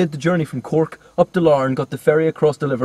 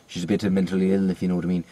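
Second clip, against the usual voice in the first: lower-pitched Irish impression; mock Irish accent; 7 semitones lower than usual. The same student later in the film, low mock Irish. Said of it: "And later he's like".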